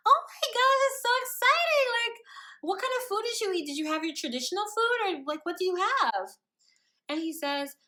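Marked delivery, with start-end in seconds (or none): 0.1-6.3 higher, louder, fast chatter; overexcited. Retold or imitated. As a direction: gush with questions. Overexcited questions.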